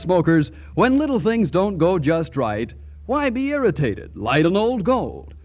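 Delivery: ordinary talk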